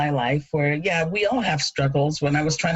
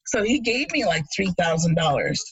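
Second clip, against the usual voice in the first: normal talking voice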